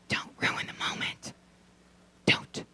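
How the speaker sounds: soft unvoiced don't; whispered hush. Telling the partner to stop talking, in a whisper. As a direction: hush them gently. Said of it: "hushing the partner".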